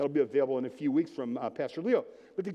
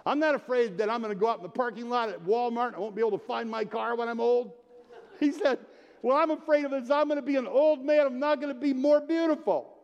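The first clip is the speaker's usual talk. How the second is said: raised, higher voice building in intensity; building louder and higher to the punchline; impassioned retold declaration; 7 semitones higher, 4 dB louder than usual. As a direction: quote a friend's big confession with full force. Retelling friend's climactic declaration of fear.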